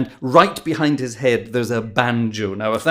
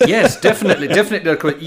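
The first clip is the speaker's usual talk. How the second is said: bright laugh running through the words, louder; 5 semitones higher, 7 dB louder than usual. Real, not acted. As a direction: laugh out the yes, then let it settle. Host delighted by guest's story.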